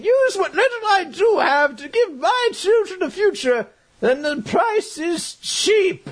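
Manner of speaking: strained high cry, last word drawn out; impassioned sacrifice speech. Retold or imitated. Old soldier giving life for children, mock-heroic shout.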